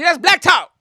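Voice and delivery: high, loud hyped-up billing; mock segment announcement. Naming the riff as a segment, loud mock announcement.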